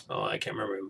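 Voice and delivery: ordinary talk, nothing marked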